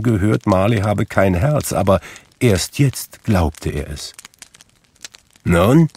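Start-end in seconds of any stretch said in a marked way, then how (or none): 5.4-5.9 curt, cold challenge; impatient old miser's curt demand; sharp and cold. A narrator's reading. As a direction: snap the single word coldly. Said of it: "sagte Scrooge scharf und kalt wie gewöhnlich".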